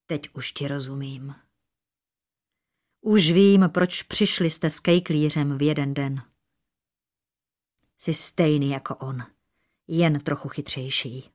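low, heavy, restrained bitterness; said quietly. A narrator's reading. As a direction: accuse in a low hushed voice. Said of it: "potichu dořekl". (potichu, s tíhou na srdci)

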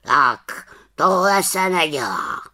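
slow, reproachful croak; a mild scolding from the raven. Acted. Raven scolds the little witch, slow and reproachful. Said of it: "řekl káravě".

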